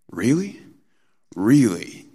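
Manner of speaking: high sarcastic rise then flat drop; deadpan scoff like a comedy bit; mocking really. Imitating the Saturday Night Live bit, sarcastic really. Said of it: "this word of mocking".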